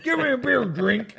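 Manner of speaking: high demanding voice; silly saloon customer. Acted. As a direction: order a beer like a goofy cowboy. Repeats high voice.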